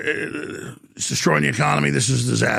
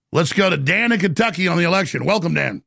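ordinary talk, nothing marked

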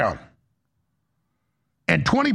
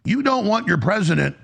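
ordinary talk

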